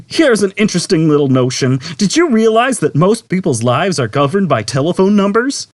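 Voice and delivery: cheery computer. A narrator's reading. Computer greeting Zaphod, sugary cheer. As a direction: greet like a game-show host.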